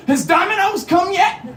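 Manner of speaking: high, loud, desperate question; retold panicked shout; frantic yell. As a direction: demand news at the top of your voice. Retold frantic arrival at the door.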